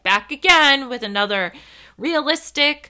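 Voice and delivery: big imitation of a show opening, phrase by phrase; Remy Bader impression. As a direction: announce it like a creator's signature opener. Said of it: "Remy Bader is always like".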